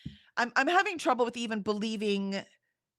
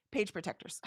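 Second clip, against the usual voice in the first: much softer, said to herself; soft repeat; 11 dB quieter than usual. Real, not acted. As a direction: murmur Page protectors almost under the breath.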